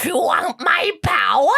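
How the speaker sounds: high slow witchy dragon voice. Acted. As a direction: croon it like a witch. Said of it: "Why am I so witchy?".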